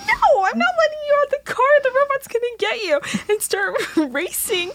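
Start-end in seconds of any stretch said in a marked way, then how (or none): 0.1-3.0 very high, frantic mock shriek; high-pitched hysterical retelling; panicked refusal. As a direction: refuse in hammed-up terror, voice sky-high. Retold panicked refusal in car.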